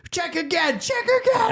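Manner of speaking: very high-pitched squawked demand; mock panic. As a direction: imitate a panicked plea, voice shooting up. Acting out panicked demand to recheck.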